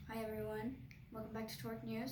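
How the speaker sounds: low, quiet, lifeless mock of dad's opening; understated boring presenter impression; imitating dad's dull intro. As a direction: mumble it softly and low, a boring host. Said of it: "'cause he always is like".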